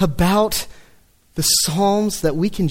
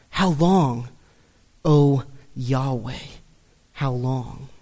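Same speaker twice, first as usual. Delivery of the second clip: quiet cry; 7 semitones lower, 4 dB quieter, slower than usual. Retold or imitated. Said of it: "crying out to him".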